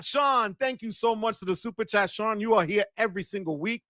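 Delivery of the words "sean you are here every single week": ordinary talk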